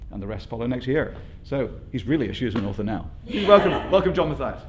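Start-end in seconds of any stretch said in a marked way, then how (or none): 3.4-4.6 raised, higher host's welcome; loud, lifted introduction; rousing welcome. Real, not acted. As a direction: welcome the guest up to applause. Host brings on the poet.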